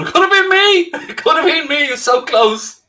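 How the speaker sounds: high, loud wail of regret; hammed-up despairing shout; mock anguished cry. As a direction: cry out like the role got away. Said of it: "watching Taxi Driver going".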